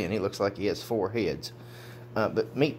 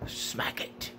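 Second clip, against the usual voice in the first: whisper; 8 dB quieter than usual. Real, not acted. Whispered urging to the dog.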